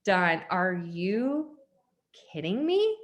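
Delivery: slow, drawn-out disbelief; mock outraged reply to the boss; exasperated. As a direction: stretch it out like you're fed up.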